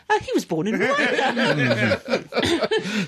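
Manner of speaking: slow, cheery, breezy tone; jolly and light. Shown as a demonstration. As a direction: say it brightly like good news. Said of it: "don't say that sentence with a".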